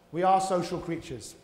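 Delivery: normal talking voice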